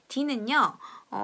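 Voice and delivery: normal talking voice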